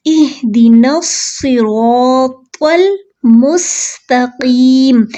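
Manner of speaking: slow, with heavy स र and त in सिरातल; heavy letters. Shown as a demonstration. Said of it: "तो ऐसे पढ़ना है". (मोटे हरूफ़ के साथ)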